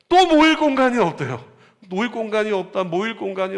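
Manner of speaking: sudden high, loud exclamation of dismay; exasperated cry. Real, not acted. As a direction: throw it out high and loud, half laughing at the problem. Pastor exclaims the lack of space.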